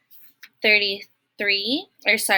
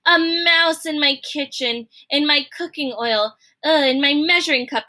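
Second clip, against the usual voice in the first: disgusted Cook; 5 semitones higher, 4 dB louder than usual. Acted. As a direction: spit out the disgust.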